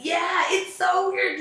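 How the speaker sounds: a sudden loud, high burst of disbelief; excited exclamation. Real, not acted. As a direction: blurt it out loud and high, amazed. Excited reaction to "wanna be directed.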